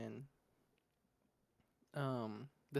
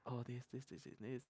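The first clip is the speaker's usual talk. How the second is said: softly imitating a whispered aside; low-volume murmur, as if into someone's ear; imitated whisper; 3 semitones lower, 7 dB quieter than usual. Retelling his remarks in the movie, hushed imitation. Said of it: "whispers in my ear".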